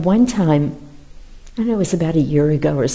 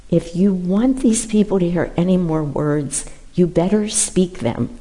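ordinary talk

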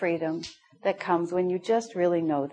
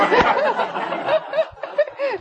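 real laughter, pitched up; high burst of laughter. Real, not acted. Laughs at her own punchline.

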